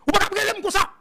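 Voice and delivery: reprend la voix du monsieur, bien plus haut et plus fort; cri imité. Retold or imitated. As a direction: imite l'interlocuteur qui s'emporte au téléphone. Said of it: "Monsieur dit". (le monsieur au téléphone, crié, voix aiguë)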